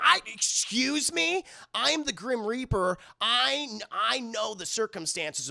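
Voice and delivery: high, drawn-out affronted retort; mock indignation. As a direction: pull rank, acting offended. Affronted Grim Reaper retorts in retelling.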